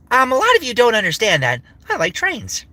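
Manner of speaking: high, lively cartoon voice; Rambly. Rambly telling listeners he likes trains, high cartoon voice. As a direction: confide it in a high, perky voice.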